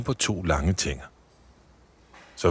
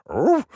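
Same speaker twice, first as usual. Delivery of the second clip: dog's grunt; 6 dB louder than usual. Acted. Dog Olsen grabs chocolate.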